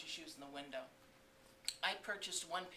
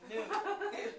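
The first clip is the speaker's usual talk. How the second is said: laughing; 7 dB louder than usual. Real, not acted. Laughing at the joke.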